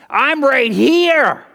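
slow, loud and high; drawn-out emphatic declaration. Acted. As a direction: lean hard on each word. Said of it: "preacher emphasizing Jesus' words".

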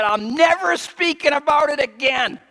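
high, loud, exasperated prophet; retelling Jeremiah quitting; Jeremiah despairing. Retold or imitated. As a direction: cry out like a prophet who's had enough. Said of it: "Jeremiah said".

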